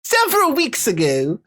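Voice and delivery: high, put-on English accent; hammed-up fake English lilt. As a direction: have another go at a bad English accent. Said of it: "Try again".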